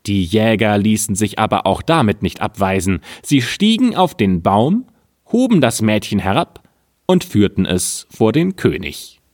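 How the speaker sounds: ordinary delivery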